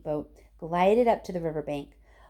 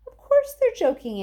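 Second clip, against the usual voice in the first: emphatic reassurance; 12 semitones higher, 6 dB louder than usual. Acted. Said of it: "answered Grandpa Joe".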